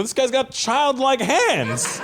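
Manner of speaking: slow, high, laughing roast. Retold or imitated. Comic roasting a guest, laughing slow and high. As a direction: tease the tiny hands through laughter.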